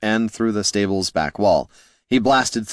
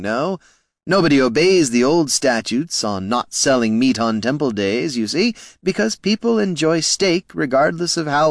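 lightly raised, silky voice of a polite schemer; courteous words with a hidden sneer; smooth gnome Flynn; 6 semitones higher than usual. A narrator's reading. Flynn needling the heroes, mock politeness.

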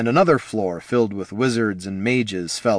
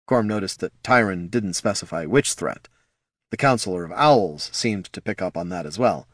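plain reading voice

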